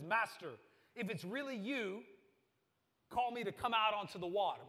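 loud bold call to the Master; suddenly bold. Retold or imitated. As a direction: dare him out boldly. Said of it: "suddenly bold".

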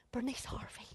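breathy soft whisper. Real, not acted. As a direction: whisper the name like a secret. Revealing the name softly.

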